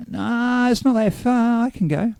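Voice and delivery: high, airy brush-off; high-pitched polite no-thanks; retold casual refusal.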